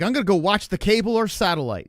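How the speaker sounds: mocking Matt R. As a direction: mimic him smugly. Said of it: "Matt R is like".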